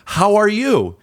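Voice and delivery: high, emphatic polite reply; strained politeness. Retold or imitated. Client answering the agent's repeated greeting, pointedly polite.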